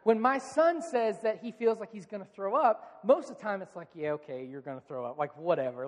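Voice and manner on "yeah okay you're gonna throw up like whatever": low, unimpressed brush-off; dismissive parent. Retold or imitated. Parent doubting son will throw up, dismissive. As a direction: drop the pitch and shrug it off.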